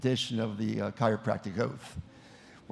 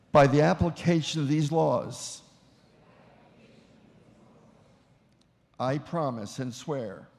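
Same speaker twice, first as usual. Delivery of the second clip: measured, solemn oath read in short phrases; formal oath recitation. Acted. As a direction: lead the room through a solemn vow. Leads the oath for repetition.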